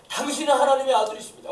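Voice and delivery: high, loud acted cry; demons crying out. Prostrate demon addressing Jesus, crying out loudly. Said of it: "부르짖어".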